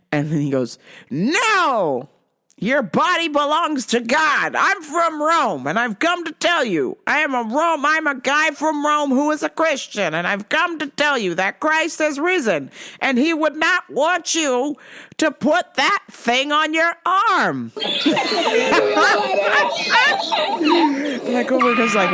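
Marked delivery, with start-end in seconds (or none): 1.1-17.7 mock zealot lecturing a cashier; preachy Rome guy. Preachy man lectures coworker. 17.8-21.2 high burst of laughing; real laughter. Real, not acted. Bursts laughing at own story.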